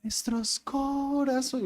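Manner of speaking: soft, slow mock-sultry delivery of a lyric; hushed, drawn-out parody of seductive singing; suggestive singer's inflection. Shown as a demonstration. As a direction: imitate a sensual singer, stretching the words softly. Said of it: "¿por qué inflexionan la voz así?".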